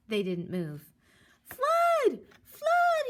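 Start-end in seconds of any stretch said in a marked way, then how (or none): 1.4-3.0 very high loud shout; crying fire. Acted. Jack tries to scare the birds off, high shout. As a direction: yell it out in alarm. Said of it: "he cried".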